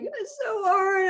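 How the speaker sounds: high, slow, near-crying whine; hammed-up teary complaint; mock whine. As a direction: wail it like a frustrated kid. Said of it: "I'm like".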